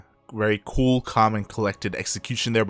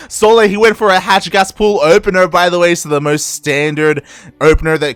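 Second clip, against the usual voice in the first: raised, urgent caster voice; keyed-up esports commentary; excited play-by-play; 8 semitones higher, 9 dB louder than usual. Real, not acted. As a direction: call the action live, climbing at the end. Live play-by-play.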